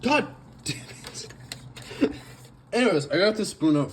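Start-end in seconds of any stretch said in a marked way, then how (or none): none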